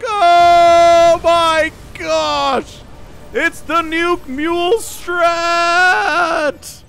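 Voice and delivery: very high, loud, drawn-out commentator yell; hype shouting. Real, not acted. Caster amazed by a nuke mule strat, hype shouting. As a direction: stretch the words into an ecstatic scream.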